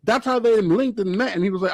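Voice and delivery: usual speaking voice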